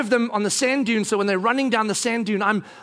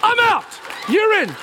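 petulant outburst; 10 semitones higher than usual. Retold or imitated. Husband storming off from wife, petulant outburst. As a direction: fire off each one in a huff.

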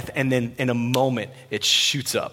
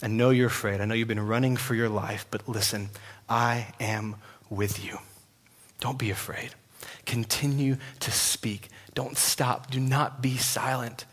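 fatherly comfort; 3 semitones lower, 6 dB quieter than usual. Acted. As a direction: comfort a scared child gently. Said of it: "fatherly affection in the voice of God".

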